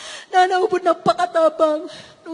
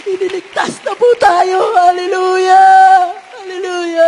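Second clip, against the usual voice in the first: triumphant shout; 2 semitones higher, 8 dB louder than usual. Real, not acted. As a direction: proclaim the rescue to the whole hall. Announcing the rescue.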